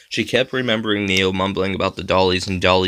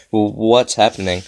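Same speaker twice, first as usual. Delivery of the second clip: stammering. A narrator's reading. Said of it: "Tamara stammered out loud".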